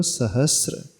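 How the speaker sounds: सहस्र slowly, s joined to r with no t; teacher's model of सहस्र, clean sr; correct sahasra. Shown as a demonstration. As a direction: sound out सहस्र as sa-has-sra. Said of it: "स्वर जुड़ा है".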